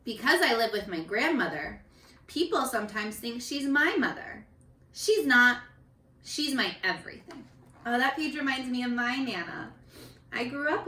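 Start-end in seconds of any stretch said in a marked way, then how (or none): 0.0-7.5 gentle storybook reading; reading to children. A narrator's reading. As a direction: read each child's page to the class. Teacher reads picture book aloud.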